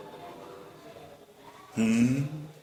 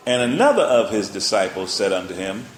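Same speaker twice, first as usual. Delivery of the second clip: louder, deliberate verse opener; scripture reading; 2 semitones lower, 4 dB louder than usual. Acted. Preacher opening the verse to the congregation, loud and deliberate. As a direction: announce the verse to the church.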